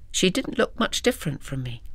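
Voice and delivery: usual speaking voice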